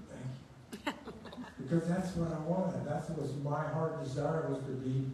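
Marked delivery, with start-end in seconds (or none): 0.1-1.1 laughing thanks. Retold or imitated. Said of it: "I said".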